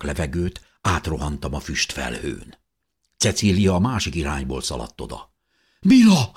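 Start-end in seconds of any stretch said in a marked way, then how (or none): 5.8-6.3 high, loud, falling cry; shouting. A narrator's reading. Mother runs through smoke to her child, shouting. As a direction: cry the name out in alarm. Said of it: "kiáltotta köhögve".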